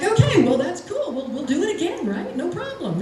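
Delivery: high, falsely breezy reassurance; forced cheer. Retold or imitated. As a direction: sound fine while it's falling apart. Covering a failed cue cheerfully.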